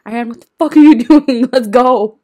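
loud, high retold shout; imitated exasperated yell, laugh breaking in; exasperated scolding. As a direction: yell at a drunk friend to leave. Acting out exasperated friend's order.

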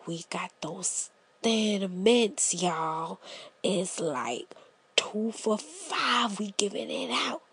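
low, soft hustler pitch in a hush; secretive whisper. Retold or imitated. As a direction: whisper the deal like a street dealer. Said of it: "And they like".

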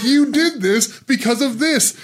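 high, eager explaining; giddy pointing out; excited telling. Retold or imitated. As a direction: burst out the insight. Retelling own words to them.

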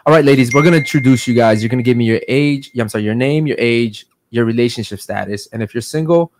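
ordinary talk

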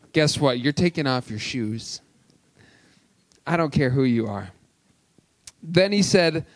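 ordinary delivery

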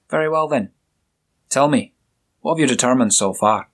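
a hint of a jovial Scottish burr; Scottish accent. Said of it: "with a strong Scottish accent".

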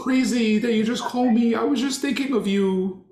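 high, mocking falsetto. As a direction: play a fake friend in falsetto. Mocking a fake caller.

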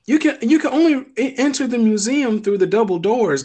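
exasperated. Real, not acted. Exasperated explaining museum doors.

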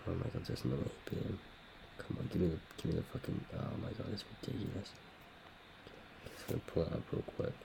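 soft, breathy muttering to himself; under his breath. Real, not acted. Waiting for the clip, muttering quietly to himself. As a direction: mumble while searching. Muttering while the video loads.

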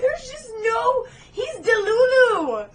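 high, loud, drawn-out exclaiming; exasperated disbelief. Real, not acted. Telling co-host the hero is deluded, exasperated wail. Incredulous rant about the chase.

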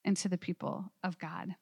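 usual speaking voice

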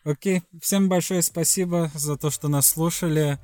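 ordinary talk, nothing marked

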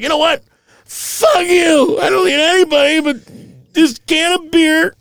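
put-on drunk skipper outburst. Drunk skipper yelling at the professor that he needs only his beer.

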